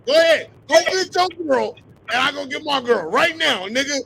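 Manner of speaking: worked-up, insistent trash talk; goading challenge. Real, not acted. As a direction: call him out loudly, hammering each demand. Goading Walt with a repeated challenge.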